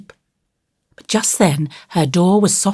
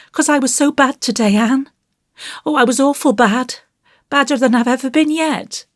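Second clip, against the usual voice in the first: put-on high, plaintive child's voice; unhappy little boy; 9 semitones higher than usual. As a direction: pour it out like a troubled small boy. Voices Davy confessing.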